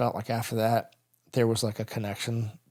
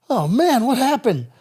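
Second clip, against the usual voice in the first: loud, high confused cry; wounded man calling out confused; dazed alarm; 12 semitones higher, 8 dB louder, slower than usual. Retold or imitated. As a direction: shout out in shock. Dazed soldier after head hit.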